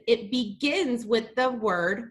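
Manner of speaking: ordinary talk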